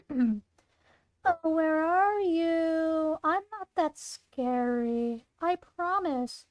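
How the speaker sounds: slow, drawn-out creepy coaxing; taunting stalker. Acted. Monster Claire hunts the hidden group, slow taunting call. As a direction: coax them out of hiding, very slowly.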